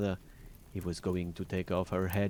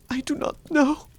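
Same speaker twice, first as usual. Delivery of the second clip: very high, louder cry of a frightened wife; 6 dB louder than usual. A narrator's reading. Poor wife denying knowledge of the bloody key, frightened high cry. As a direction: cry it out in high-pitched alarm. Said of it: "cried the poor woman".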